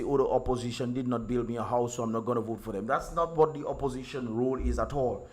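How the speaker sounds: normal talking voice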